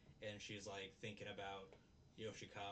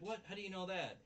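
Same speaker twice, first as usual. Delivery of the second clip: shocked; 9 semitones higher, 4 dB louder, faster than usual. Retold or imitated. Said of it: "she's like".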